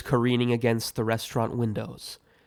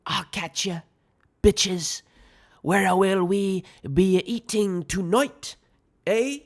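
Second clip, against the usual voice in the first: high-pitched attempt at a British accent; hammed-up British, rising on the last word; bad British accent; 8 semitones higher, slower than usual. A narrator's reading. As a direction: put on an exaggerated, amateurish British accent. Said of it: "attempt at a British accent".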